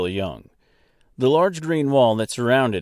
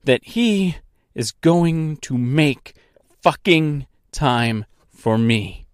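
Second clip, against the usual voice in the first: angry ultimatum, short of a real scream; furious demand; 3 semitones higher, slower than usual. Acted. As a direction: hammer it out word by word in anger. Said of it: "screaming at the top of her lungs".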